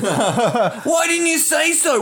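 high-pitched comic panic; mock alarm. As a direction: shriek it in pretend disbelief and alarm. Acts out alarmed listeners to the sneeze.

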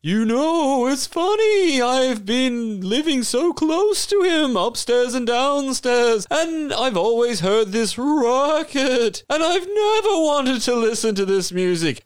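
very high, slow, spooky ghost voice; a drawn-out spooky wail of a ghost. Acted. A ghost introducing himself after slapping the player, high and spooky.

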